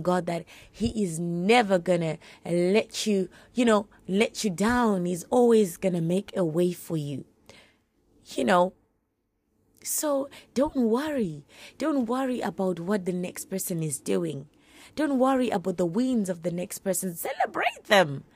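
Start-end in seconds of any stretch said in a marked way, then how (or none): none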